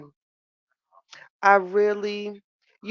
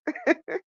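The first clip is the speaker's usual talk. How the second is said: laugh; 12 semitones higher, 3 dB quieter than usual. Real, not acted. Reacting to chat support.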